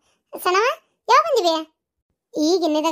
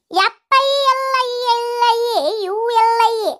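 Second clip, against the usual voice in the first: slow spelling out; 7 semitones higher, slower than usual. Acted. Slowly spelling out medical report.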